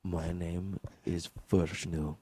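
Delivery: put-on slow, low, hushed Indian accent; Vishnu. Caller introducing himself to operator, low Indian accent. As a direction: murmur it shyly in an Indian accent. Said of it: "an Indian dude on the sex".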